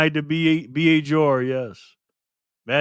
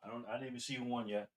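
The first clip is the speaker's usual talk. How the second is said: usual speaking voice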